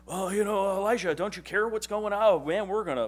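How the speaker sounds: high, loud mock panic; mock panicked servant. Servant panicking to Elijah, high and loud mock panic. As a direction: fret like a scared servant. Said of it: "And he said".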